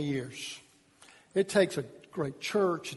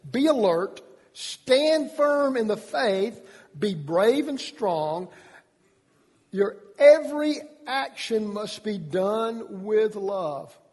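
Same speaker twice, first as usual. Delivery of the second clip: emphatic scripture reading; 2 semitones higher, much slower than usual. Acted. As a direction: read the verse like a charge to the church.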